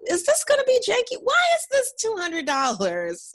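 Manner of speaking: high, incredulous questioning; suspicious customer. Acted. Friend voicing her own doubts as a customer, incredulous. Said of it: "it's gonna feel like".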